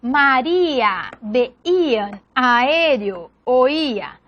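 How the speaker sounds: "María, veían, aéreo, oía" slowly, vowels held apart; "María, veían, aéreo, oía" drawn out vowel by vowel; hiatus separation. Shown as a demonstration. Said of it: "siempre van a separarse".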